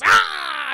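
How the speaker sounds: sudden loud high yell; mock jump-scare yell. Chucky popping up at Tyler, loud jump-scare yell. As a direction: leap out and yell to startle. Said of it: "Chucky goes".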